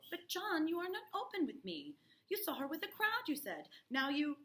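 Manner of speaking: wife desperate to be heard; pleading. Acted. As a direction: push back, pleading hard. Wife pleading, much higher.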